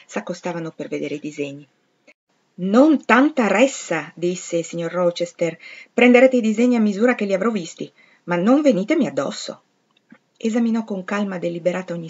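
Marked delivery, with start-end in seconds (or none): 2.6-4.1 loud, higher, drawn-out command; slow, emphatic call for room; raised-voice order. A narrator's reading. Rochester orders the onlookers back. 5.9-9.6 louder, higher, emphatic command; narrated scolding, pushing people away; impatient order. A narrator's reading. Rochester keeps onlookers off him.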